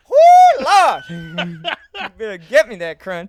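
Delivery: a laughing Southern holler at the counter; mock Southern accent. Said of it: "I don't know what accent you're doing".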